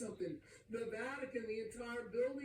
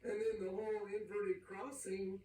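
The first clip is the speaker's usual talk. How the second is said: ordinary talk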